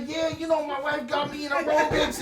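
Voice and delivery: high, chatty imitation of an old man; lightly voiced old resident chatting; mock old man. Imitating an old man at the home, high and chatty. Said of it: "I'm like".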